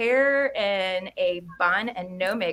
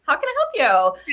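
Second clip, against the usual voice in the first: mock sweet greeting; 8 semitones higher, 5 dB louder than usual. Retold reveal as technician.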